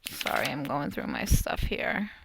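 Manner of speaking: half-whispered aside to herself; whispering. Real, not acted. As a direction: mutter it under your breath while searching. Aside while searching papers.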